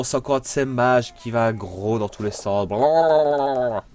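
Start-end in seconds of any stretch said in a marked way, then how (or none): 2.7-3.8 slow, sing-song mock chatter; mock the opponent with drawn-out babble; slow derisive blablabla; mocking blah blah.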